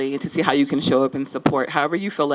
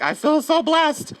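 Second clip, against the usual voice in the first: high, slow, gushing mock squeal; gushing gratitude; 11 semitones higher, slower than usual. Artist retelling her reaction to a free lesson, gushing. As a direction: play up being overwhelmed with thanks, way up high. Quotes own grateful reaction.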